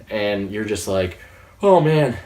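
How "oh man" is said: high, loud burst of anticipation; excited reaction. Retold or imitated. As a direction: exclaim like a hyped viewer. Acting out viewer's excited reaction.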